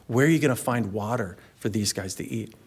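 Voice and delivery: ordinary talk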